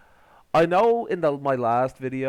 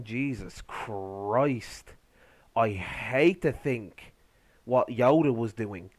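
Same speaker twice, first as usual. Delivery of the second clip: slow, low, exasperated; weary dismay; 4 semitones lower, much slower than usual. Real, not acted. Host despairing over Obi-Wan's backstory, weary dismay. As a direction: drag it out low in disbelief.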